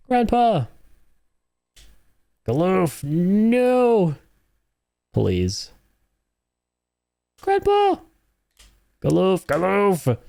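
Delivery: anguished yelling. Real, not acted. Gamer crying out to dying Galoof on screen, high desperate yell. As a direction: call out to him as he falls.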